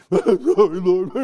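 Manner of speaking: loud, high imitation of blubbering; hammed-up sobbing apology; mock bawling. Young trucker begging the Lord's pardon, mock bawling. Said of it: "Now I'm bawling".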